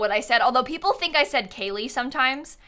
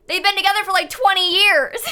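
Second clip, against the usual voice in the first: incredulous; 9 semitones higher, 6 dB louder than usual. Real, not acted. Shocked at own Sims couple.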